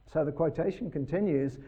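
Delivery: ordinary talk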